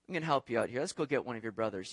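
low, soft, quick aside; quietly recruit the kid for mischief; imitating a dad's hushed scheming; conspiratorial aside. Dad plotting with young son.

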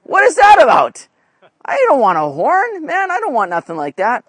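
high, baffled "huh, what is that about"; puzzled reader. Retold or imitated. Preacher imitates a confused Bible reader, high and baffled. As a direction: play a confused churchgoer who doesn't get the verse. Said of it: "reading that going".

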